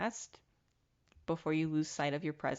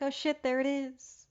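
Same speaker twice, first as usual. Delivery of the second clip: retold surprise; 7 semitones higher than usual. Retold surprise at spotting moon.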